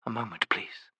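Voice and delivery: soft, breathy plea; whispered. A narrator's reading. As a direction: whisper it, barely there. Asks for a pause.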